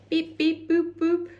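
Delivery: high-pitched robot beeps. Acted. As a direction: beep and boop like a little robot up high. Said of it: "in a robot voice".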